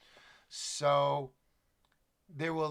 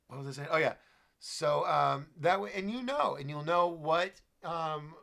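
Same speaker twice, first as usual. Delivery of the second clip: ordinary talk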